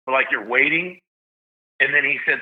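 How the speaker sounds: ordinary talk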